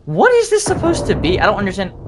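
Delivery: very high-pitched disbelief; bewildered. Real, not acted. Player puzzled by the game's scenery, high bewildered questioning. Baffled by a strange game sight.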